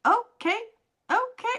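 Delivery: high, rising amazed okay, okay; impressed okay. Retold or imitated. Reacting to first hearing song.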